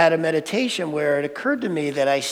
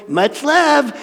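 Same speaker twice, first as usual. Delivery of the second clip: mocking echo; 7 semitones higher than usual. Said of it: "I'm in the other room going".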